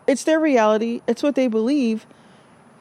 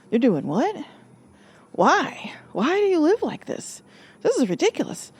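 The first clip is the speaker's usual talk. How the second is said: incredulous; 6 semitones higher than usual. Retold or imitated. Mocking an outsider's disbelief.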